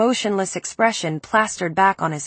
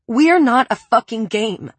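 choked, pressed out, a bit louder; 4 semitones higher, 4 dB louder than usual. Acted. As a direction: force it out through a tight throat. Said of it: "I choked out".

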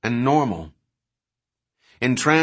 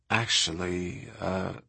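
soft, hesitant admission; guilty; 3 semitones lower, 7 dB quieter, slower than usual. A narrator's reading. Kelly admits to Sarah that she likes math, sort of guiltily. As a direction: confess it quietly, a little guiltily. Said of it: "in a sort of guilty voice".

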